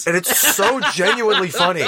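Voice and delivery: high, laughing talk. Real, not acted. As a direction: talk while still laughing. Both laughing at the joke.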